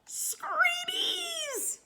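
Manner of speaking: very high, loud startled scream. A narrator's reading. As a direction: scream it in terror as the little hatmaker. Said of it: "screamed Sir Snips".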